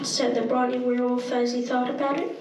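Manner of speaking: usual speaking voice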